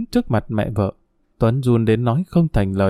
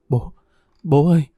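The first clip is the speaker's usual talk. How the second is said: sobbing cry; 3 semitones higher than usual. A narrator's reading. Tuấn kneeling before his late father-in-law's portrait, sobbing. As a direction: call out to him through tears. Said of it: "Tuấn vừa khóc, vừa nức nở".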